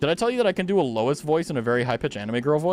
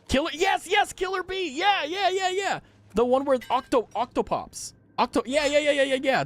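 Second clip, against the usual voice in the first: excited anime fan; 12 semitones higher than usual. Real, not acted. Excited naming anime character.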